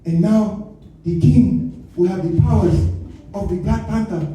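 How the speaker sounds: hammed-up African accent, slow and halting; mock film elder with an overdone African accent; Forest Whitaker. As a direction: African accent with a high trilled burst. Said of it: "He in the movie like this".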